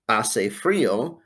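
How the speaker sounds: "Hace frío" said slowly, silent H, stress on FRÍ; slow teacher model of "Hace frío"; slow Spanish model. Shown as a demonstration. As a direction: modelo lento. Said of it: "four answers that begin with a word called hace".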